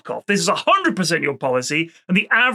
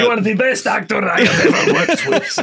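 hammy luvvie actor; 4 semitones higher than usual. Pretend. Mock actor and director exchange.

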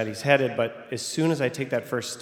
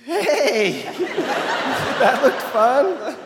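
much higher, bright and laughing, slowed; cheery high greeting; 13 semitones higher, 6 dB louder, much slower than usual. Retold or imitated. To the kid he just caught, high laughing greeting. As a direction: greet the kid like it was nothing. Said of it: "and I'm like".

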